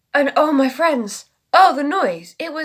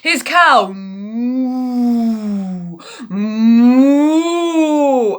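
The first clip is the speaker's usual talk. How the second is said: cow moo; 5 dB louder, much slower than usual. Retold or imitated. Cow noise in story.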